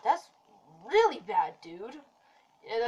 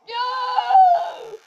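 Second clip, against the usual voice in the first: retold shout; 13 semitones higher, 7 dB louder than usual. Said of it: "I was like".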